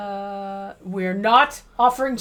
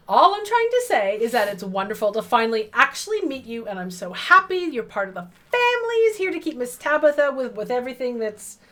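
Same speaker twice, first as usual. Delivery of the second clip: Midwest mom voice; 3 semitones higher, 4 dB louder than usual. Acted. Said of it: "Midwest mom voice".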